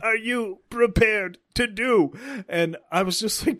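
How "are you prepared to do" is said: slow, raised, deliberate challenge; mock-dramatic quoted line, stretched out; dramatic movie line. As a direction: draw it out like a big movie moment.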